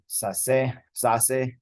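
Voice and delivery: "sase" with the C said as an S; S-sound pronunciation. Shown as a demonstration. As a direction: demonstrate "sase" with an S sound, not a K. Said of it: "C is pronounced like an S sound there".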